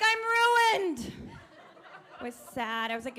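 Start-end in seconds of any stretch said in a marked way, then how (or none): 0.0-1.1 high, loud mock despair of a small boy; overacted child's wail; dramatic kid. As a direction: whine it like a devastated five-year-old. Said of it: "He was like".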